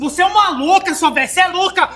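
scornful outburst. Acted. Boyfriend insults the mother.

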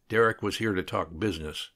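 ordinary delivery, nothing marked

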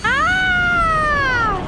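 long high-pitched "ah" scream; startled scream. Real, not acted. Startled by the creepy Skibidi toilet, screaming. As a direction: cry out in drawn-out fright.

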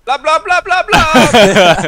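high, loud, laughing blub noises; shouting underwater. Pretend. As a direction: yell bubbles like you're drowning. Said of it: "can we get a blub blub blub?".

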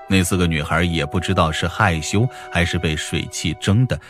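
plain reading voice

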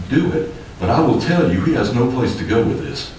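ordinary talk

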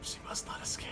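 menacing villain whisper. Pretend. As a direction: hiss it like a stalking villain. Repeated whispered.

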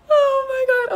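loud high gasp, crying out; shocked gasp into a tearful cry. Real, not acted. Realizing she drank coffee while pregnant, crying out in shock.

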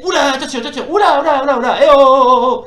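high, loud rising alarm; alarmed cries. Real, not acted. Gamer under fast attack from Yoon, loud alarmed cries. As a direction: yelp as the hits come in.

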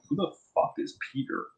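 a hushed, puzzled aside; whispered confusion. Retold or imitated. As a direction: whisper it, baffled.

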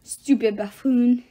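put-on slow, loud French-accented grumble; grumbling French accent. A narrator's reading. Love god insults his brother, grumbling French accent. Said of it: "his brother grumbled".

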